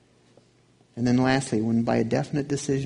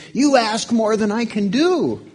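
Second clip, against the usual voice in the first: higher, louder, slow protesting whine; Cain's complaint; 10 semitones higher, 7 dB louder than usual. Retold or imitated. Cain protesting to God that it's too much, slow and loud. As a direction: whine it out like a man wronged. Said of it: "I'm not like Cain saying".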